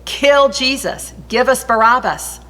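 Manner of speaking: a read-aloud crowd shouting for Barabbas. A narrator's reading. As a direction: call it out like an angry mob. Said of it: "the whole crowd shouted".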